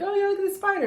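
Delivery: high mock-excited voice; playful high-pitched quote; teasing husband.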